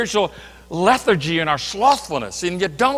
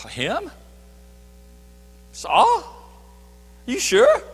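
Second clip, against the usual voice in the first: higher, rising in disbelief on "Saul"; incredulous Ananias; 9 semitones higher, much slower than usual. Retold or imitated. Ananias questioning God about Saul, higher and incredulous. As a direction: balk at the idea like he misheard.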